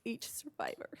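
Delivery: fighting back real tears mid-sentence; tearful. Real, not acted. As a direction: voice choking up, dropping to a whisper at times. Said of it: "I don't know why I'm emotional".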